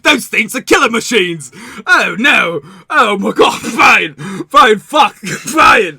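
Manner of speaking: loud, high mock panic; horrified protest. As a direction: recoil in hammed-up horror. Merchant horrified at handling guns.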